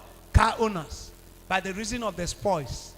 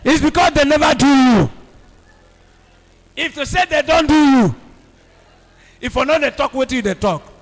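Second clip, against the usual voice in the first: very loud, rousing chant; loud preaching call and response; loud call to repeat; 3 semitones higher, 12 dB louder than usual. Real, not acted. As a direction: drive the congregation to shout it back. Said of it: "Another person say".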